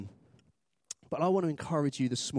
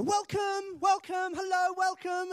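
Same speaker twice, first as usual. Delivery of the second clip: very high, sing-out welcome; hammed-up welcome team; gushing church greeter; slower than usual. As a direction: greet everyone in an over-keen high voice. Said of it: "welcome team in churches are a, are a special type of people".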